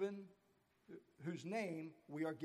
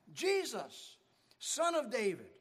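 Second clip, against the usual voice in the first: crying out; 5 semitones higher, 6 dB louder than usual. Retold or imitated. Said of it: "And so he cries out".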